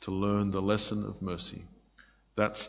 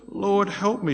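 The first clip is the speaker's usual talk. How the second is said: higher-pitched imitated plea; retold pleading; 8 semitones higher, 3 dB louder than usual. Woman kneeling before Jesus for help, imitated pleading. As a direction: voice her appeal, pitch lifted. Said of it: "She's again pleading with him".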